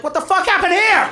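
high, disbelieving outburst; shocked. Retold or imitated. Parent coming home to find a Walmart in the backyard, shocked. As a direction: gape at it in disbelief. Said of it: "You're like".

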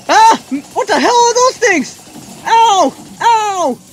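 high, drawn-out cries of ow; alarmed yelping. Real, not acted. Player battered by strange things in game, alarmed. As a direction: yelp as each hit lands. Hit repeatedly by unknown attacks.

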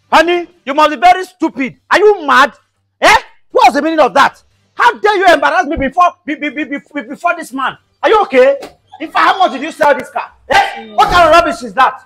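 angry yelling. Acted. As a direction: yell at her in outrage. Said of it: "You are yelling".

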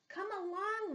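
a hint of a little mouse calling; little mouse calling friends; squeaky mouse. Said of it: "Mouse squeaks".

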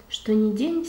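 ordinary delivery

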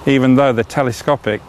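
ordinary talk

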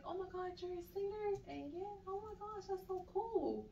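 high-pitched mock gushing; imitated gushing excitement. Woman hearing she's a singer, imitated high-pitched gushing. Acts out an admiring woman's reaction.

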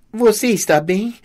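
high-pitched, anxious check on someone; concerned question. A narrator's reading. Narrator tearfully hugs brother, asks. (pergunta preocupada, em tom agudo)

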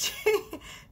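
breathy squeaky laugh; quick high laugh; high airy giggle. Real, not acted. Laughs mid-sentence.

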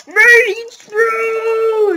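high, loud drawn-out yell; battle cry. Real, not acted. Gamer cheering his item on, loud battle cry. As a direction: roar it like a charge.

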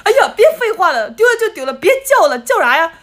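hamming up a friend's grumpy scolding; mock scolding. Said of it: "又把我骂的说".

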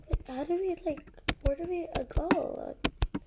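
high, loud, quick flustered asking; frantic question. Real, not acted. Player panicking about where to go, frantic question. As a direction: rush it out, flustered.

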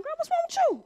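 high-pitched, incredulous retold question; mock startled dad. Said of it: "Dad looked at me like".

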